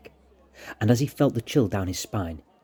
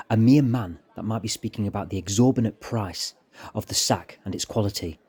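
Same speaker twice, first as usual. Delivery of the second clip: plain reading voice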